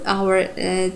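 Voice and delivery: normal talking voice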